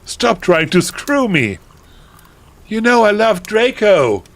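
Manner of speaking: high, loud protest; indignant shout. A narrator's reading. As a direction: shout it like a melodramatic teenager. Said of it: "I shouted".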